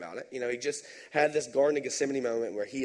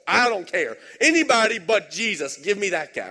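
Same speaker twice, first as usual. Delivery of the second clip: mob demand; 6 semitones higher, 8 dB louder than usual. Retold or imitated. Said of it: "And they say".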